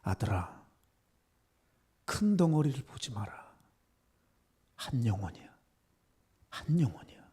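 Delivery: hushed, low divine voice with long pauses; quiet, solemn fatherly address; voice of the Lord. Retold or imitated. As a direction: speak softly, like God inside the heart. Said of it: "주님의 말씀이 제 마음에 있었어요".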